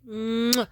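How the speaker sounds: loud smacking kiss; kiss sound. Retold or imitated. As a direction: smack a kiss on her forehead. He kisses her forehead.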